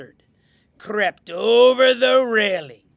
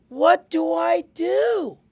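high, drawn-out excited question; flustered; 8 semitones higher, slower than usual. Acted. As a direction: ask it high and eager. Flustered Martina asks for advice.

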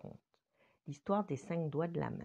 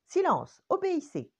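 higher, louder sharp command; stern order; 12 semitones higher, 8 dB louder than usual. Acted. Manu orders her squabbling fingers to obey.

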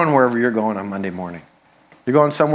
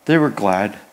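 low, understated delivery; 5 semitones lower than usual. Acted. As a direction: say it with dry understatement. Deadpan echo of understated verse.

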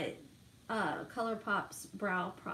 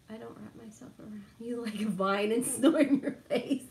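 laughing. Retold or imitated. Retold husband quote, laughing.

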